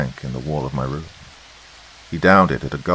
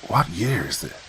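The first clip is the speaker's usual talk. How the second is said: sharp command; 3 semitones higher than usual. A narrator's reading. Visitor demanding an answer, mildly sharp. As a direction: demand the year, without shouting. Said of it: "he commanded sharply".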